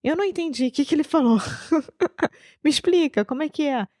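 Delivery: high, puzzled voice imitating Foster; imitating confused Foster. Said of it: "ele já fica assim". (imitando o Foster confuso, voz aguda e perdida)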